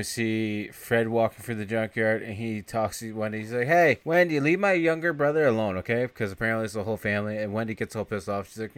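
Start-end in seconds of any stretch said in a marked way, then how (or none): 3.7-6.1 higher, louder mock telling-off; mock scolding. Fred telling Wendy to leave his brother alone, raised and higher. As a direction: warn her off in a raised voice.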